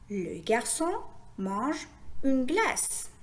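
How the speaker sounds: slow, connected model reading of the sentence; slow phrase reading. Shown as a demonstration. Teacher rereading the whole sentence for learners, slowly joined. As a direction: join the words into slow little groups. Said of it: "Essayons maintenant de lire une phrase".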